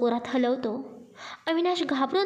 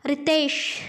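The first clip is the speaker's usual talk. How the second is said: calls out Ritesh, a little louder; shouting a name; 2 semitones higher, 3 dB louder, much slower than usual. Acted. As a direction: summon him with a sharp call. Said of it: "तो जोरात ओरडतो". (रितेशला मोठ्याने हाक)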